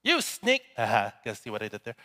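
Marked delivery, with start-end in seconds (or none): 0.0-0.6 high-pitched cry of accusation; acted shout. Betrayed scorpion to snake across the river, acted shout. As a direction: call it out high, as across water. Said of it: "He shouts across the water".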